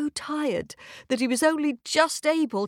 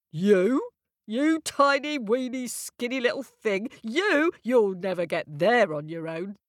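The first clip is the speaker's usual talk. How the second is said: scornful wind; 2 semitones higher than usual. Acted. North Wind scoffing at the tiny girl, mocking. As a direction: scoff at how small she is.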